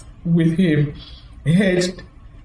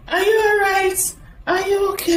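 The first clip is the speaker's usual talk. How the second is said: higher, lightly mocking concerned relatives; 13 semitones higher than usual. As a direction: imitate the fussing relatives, higher. Relatives asking, imitated.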